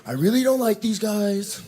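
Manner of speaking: high-pitched ranting goose leader; Goose Hitler. Acted. Goose Hitler at the front railing against pigeons, high-pitched disgusted voice. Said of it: "Goose Hitler's just at the front, like".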